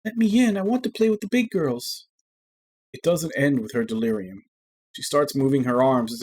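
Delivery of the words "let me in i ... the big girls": put-on high, pleading child's voice; a sick girl begging to join the big girls; delirious little girl. A narrator's reading. Narrator voicing a delirious little girl, high and pleading.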